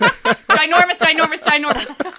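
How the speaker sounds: loud laughing chant of a word; laughing teasing. Real, not acted. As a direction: tease them through laughter.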